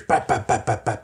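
rapid-fire spoken "bap" smacks; imitated smacking noises. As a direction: mimic quick pats putting out a fire. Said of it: "then she hears".

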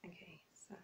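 hushed aside; whisper. Real, not acted. A prankster on a call, whispering aside. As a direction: whisper it to yourself.

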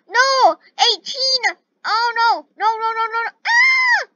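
high, loud panicked wailing; panicked cries. Real, not acted. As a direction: cry out as your friend is lost. Number eighteen being eliminated.

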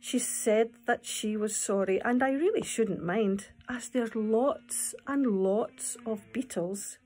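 lively storytelling read-aloud; poem reading. Acted. Teacher reading the beetle poem to children.

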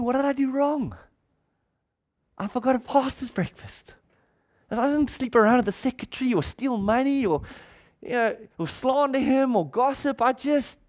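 high, wounded disbelief; anguished inner thoughts. Retold or imitated. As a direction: replay the hurt protest in your head, pitched high.

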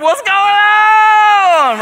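high, slow, stretched-out confused shout; bewildered cry. Acted. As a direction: wake up baffled in grave clothes. Lazarus waking confused.

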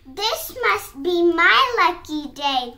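slow, loud proclamation; storybook shout. A narrator's reading. Fox delighted that dinner has arrived, shouting. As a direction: shout it out slowly, savoring each word. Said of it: "the fox shouted".

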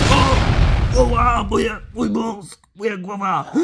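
high-pitched moaning in mock pain; hammed-up pain. Fighter hit on the head, hammed-up pain. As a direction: play hurt, crying out high.

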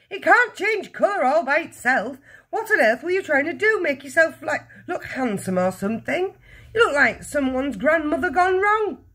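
high, loud scornful scolding; crying out. A narrator's reading. Mother berates husband over his looks, crying out. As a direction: scold him at a high pitch. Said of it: "the mother cried".

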